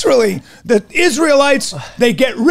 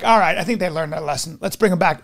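lower, softer mock-benevolent God; a deep, calm lord relenting; God's voice; 5 semitones lower, 5 dB quieter than usual. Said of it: "God is like".

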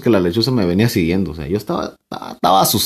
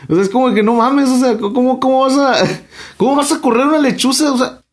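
incredulous; 12 semitones higher than usual. Real, not acted. Disbelief at the spell working.

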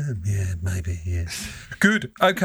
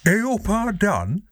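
higher, mock Yorkshire greeting; Leeds accent; 10 semitones higher, slower than usual. Caller repeating brother's greeting in disbelief, mock Leeds accent. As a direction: parrot the Leeds accent, pitched up. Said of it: "he's developed a Leeds accent".

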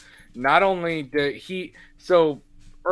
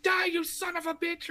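much higher, imitating a fierce yell; mock battle cry; 5 dB quieter than usual. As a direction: ham up a furious kill cry. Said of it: "She's like".

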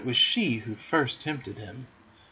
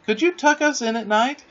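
high, coaxing question; artful childlike pleading; sly; 11 semitones higher, 4 dB louder than usual. A narrator's reading. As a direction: wheedle her with a lifted voice. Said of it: "he said, the sly one".

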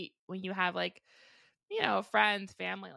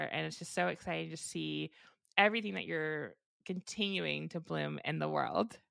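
usual speaking voice

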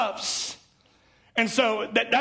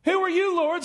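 higher startled cry of a lightly voiced Saul; a hint of Saul, struck down, calling up higher; 9 semitones higher than usual. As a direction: call up in alarm from the ground. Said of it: "Saul asked".

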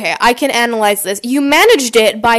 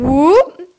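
higher rising whoop; 4 semitones higher, 3 dB louder than usual. Retold or imitated. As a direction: whoop swooping up high. Imitates kids tipping their chairs.

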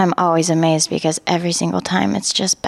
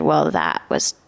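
much lower, slow, dismissive voice; lowered, sneering inner critic; 9 semitones lower, slower than usual. Retold or imitated. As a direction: drop low and belittle like the critic. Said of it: "my inner critic automatically said".

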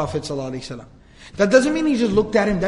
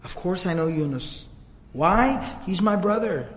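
soft, gentle closeness; tender; 7 dB quieter, slower than usual. Retold or imitated. The Prophet telling Addas that Yunus is his brother, tender. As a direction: say it quietly, as of a dear brother.

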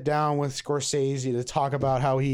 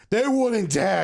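lightly imitated film line, pitched up; mock dramatic actor impression, higher; Al Pacino; 5 semitones higher, slower than usual. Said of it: "no offense to Al Pacino".

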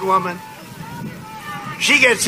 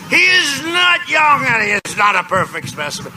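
mocking the press; 3 semitones higher than usual. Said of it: "They went crazy. He's".

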